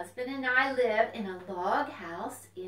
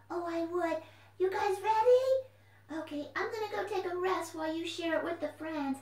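a bouncy little puppet popping up; puppet fox voice; 6 semitones higher than usual. Acted. Puppet Corky leaves.

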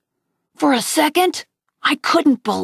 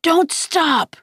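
higher-pitched yell, held back in volume; urgent yell; 6 semitones higher than usual. A narrator's reading. Boy pulling his friend along as they flee, understated yell. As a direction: urge a runner on with a restrained yell. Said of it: "would yell".

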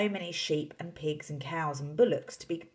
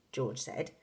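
ordinary delivery

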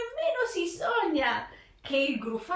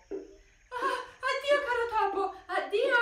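high, fast frightened farewell; breathless goodbye; 10 semitones higher, 3 dB quieter than usual. Acted. Fox blurting a frightened goodbye in one breath, high and fast. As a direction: gasp it out in one breath and flee. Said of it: "d'un fiato esclamò".

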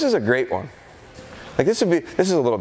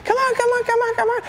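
very high, gushing come on calls; excited puppy call. Shown as a demonstration. Trainer calling the puppy over, very high excited coaxing. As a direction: coax the puppy in with squeaky delight. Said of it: "Instead, what I do is I go".

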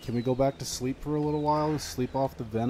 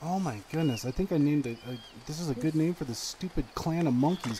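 ordinary talk, nothing marked

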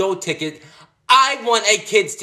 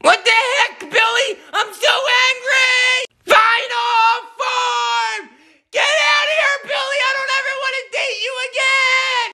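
much higher furious screaming; furious shrieking. Acted. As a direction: shriek your rage at a cheating boyfriend. Catches boyfriend, "I'm so angry.

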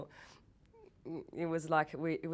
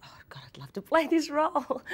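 breathy excited thought, pitched high; retold burst of excitement; 11 semitones higher, 7 dB louder than usual. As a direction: gush with sudden longing. Retelling her thrilled first reaction.

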